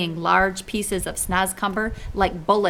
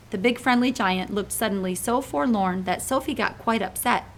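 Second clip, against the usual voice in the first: ordinary delivery, nothing marked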